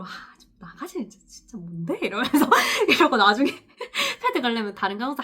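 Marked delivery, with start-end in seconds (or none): 0.0-2.0 much softer annoyed mumble; inner grumble. Retold or imitated. Nurse silently wishing the guardian would leave, soft annoyed grumble. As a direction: grumble it to yourself, fed up. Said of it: "속으로".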